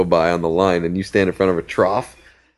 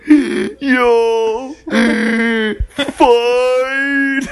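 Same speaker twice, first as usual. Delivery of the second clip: high wheezy cheer; 3 dB louder, much slower than usual. Retold or imitated. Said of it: "he's like".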